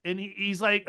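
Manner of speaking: normal talking voice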